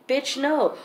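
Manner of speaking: sharp, high-pitched flat no; indignant refusal. Real, not acted. Mother recalling the guardian offering candy, indignantly refusing. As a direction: shut the offer down with contempt.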